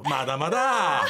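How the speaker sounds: drawn-out, teasing "not yet"; mock countdown taunt; gleeful stalling. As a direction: stretch it out slowly, relishing the wait. Imagined staff stalling caller while clock-watching.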